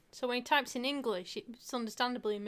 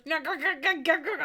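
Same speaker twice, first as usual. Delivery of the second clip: louder, higher gabble of panic; frantic brain; 5 semitones higher, 6 dB louder than usual. Acted. Typist voicing their brain derailing touch typing, frantic. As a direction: play a brain short-circuiting mid-task.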